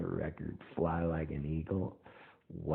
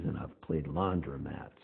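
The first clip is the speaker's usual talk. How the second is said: usual speaking voice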